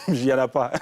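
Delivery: laughs it off, then answers through the laugh; real laugh before a short teasing answer. Real, not acted. (rire franc puis réponse en riant)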